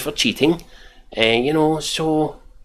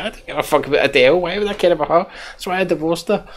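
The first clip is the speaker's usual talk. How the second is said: mock indignant; 7 semitones higher, faster than usual. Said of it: "He's like".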